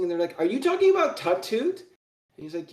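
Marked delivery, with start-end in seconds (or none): 0.4-1.8 high, excited question; eager imps. Acted. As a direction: perk up at the name. Imps perk up at the name.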